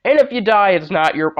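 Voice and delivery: high-pitched field-trip announcer; mock chirpy teacher. Teacher telling kids about a risky field trip, mock chirpy.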